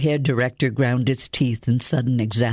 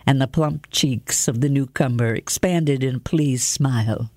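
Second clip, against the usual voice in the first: ordinary delivery, nothing marked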